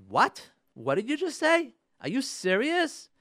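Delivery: high, incredulous questioning; offended disbelief. Acted. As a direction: act out a wife taking offence at her husband. Acted offended reaction to husband's words.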